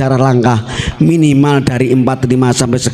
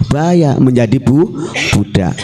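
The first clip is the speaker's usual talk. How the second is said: usual speaking voice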